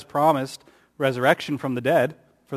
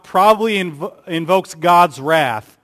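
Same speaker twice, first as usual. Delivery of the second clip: ordinary delivery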